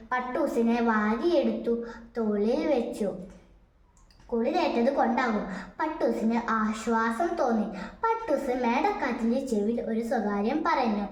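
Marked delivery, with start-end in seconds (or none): none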